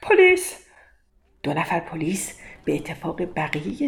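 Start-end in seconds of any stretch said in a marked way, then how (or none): 0.0-0.6 high, loud cry for the police; shouting for police. Acted. Rich man shouting from the shop door for police, loud high yell. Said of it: "فریاد زد".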